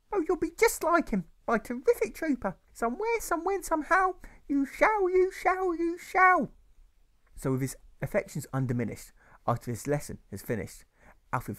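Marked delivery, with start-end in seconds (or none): 0.1-6.5 very high, louder slurred gushing voice; a tipsy mother gushing over her son; slurring mother. Acted. Mother gushing at her son instead of answering, slurred.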